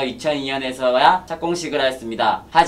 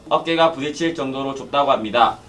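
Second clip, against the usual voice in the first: normal talking voice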